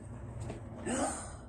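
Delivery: sharp intake of breath; long breathy gasp; astonished gasp. Real, not acted. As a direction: gasp in real surprise. Sees another gold one.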